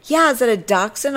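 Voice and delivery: high, perky questioning voice; imitated eager stranger. Stranger insisting on the dog's breed, high mock perkiness. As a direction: imitate someone oblivious and keen.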